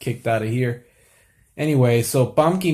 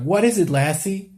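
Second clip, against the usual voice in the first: higher, eager question to the dog; mock Lassie owner; 8 semitones higher than usual. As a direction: coax the dog to tell you. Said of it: "they talk to the dog and they're like".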